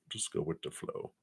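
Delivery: breathy whispered repeat of the phrase. Shown as a demonstration. Reader repeating advice to viewer, whispered. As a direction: whisper it like a secret tip.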